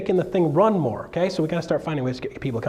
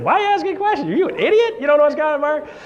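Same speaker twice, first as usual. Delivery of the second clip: much higher, louder, scoffing; higher, louder ridicule; mocking woman; 11 semitones higher, 4 dB louder, faster than usual. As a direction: scoff like she's calling you an idiot. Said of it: "She's like".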